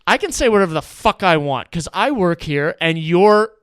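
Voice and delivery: loud, fed-up threat; angry shouting. Retold or imitated. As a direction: bark it at the whole table. Bartender confronting the table.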